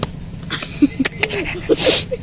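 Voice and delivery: soft, high, mostly voiceless giggle; teasing laugh. Real, not acted. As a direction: snicker quietly in a high pitch. Laughing at the signature attempt.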